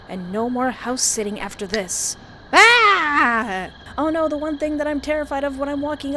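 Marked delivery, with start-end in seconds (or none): 2.5-3.7 loud high shriek; startled scream. Acted. Walking alone, grabbed, screaming. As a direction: scream in fright.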